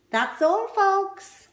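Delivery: high, loud cartoon announcer; high loud that's-all-folks; cartoon sign-off. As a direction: close the show with a flourish.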